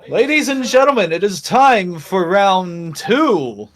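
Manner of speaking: loud, high, slow ring announcer; centaur announcer voice. Acted. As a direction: boom it out like a fight announcer. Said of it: "it sounds like the centaur's voice saying".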